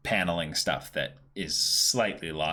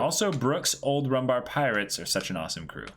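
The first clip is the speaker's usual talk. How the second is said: bright, high chat-reading voice, pitched well up; upbeat message read; 5 semitones higher than usual. Acted. Host reads a viewer's donation message aloud on stream, pitched-up upbeat read. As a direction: read the donation out like a cheerful announcement.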